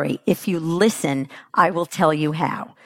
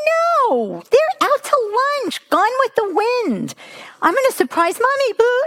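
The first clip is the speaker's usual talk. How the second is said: very high, cartoonish child voice; hammed-up scatterbrained child. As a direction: play an oblivious, excited little kid. Mocks idea of a planning child.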